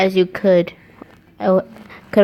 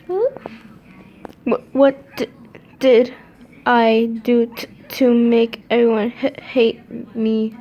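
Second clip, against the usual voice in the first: stuttering sob; 4 semitones higher, slower than usual. A narrator's reading.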